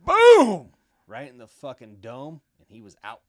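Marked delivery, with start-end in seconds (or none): 0.1-0.7 loud punch sound effect; sudden shouted boom. Retold or imitated. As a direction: yell the punch like a comic.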